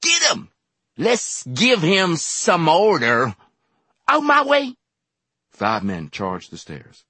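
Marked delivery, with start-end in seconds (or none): 0.0-4.8 high loud battle cries; wild mob yells; mob shouting. A narrator's reading. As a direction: charge the stairs yelling. Men rushing the stairs.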